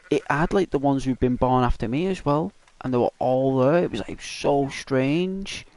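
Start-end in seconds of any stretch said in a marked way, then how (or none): none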